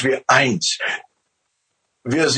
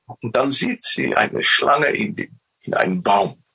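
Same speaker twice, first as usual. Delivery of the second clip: usual speaking voice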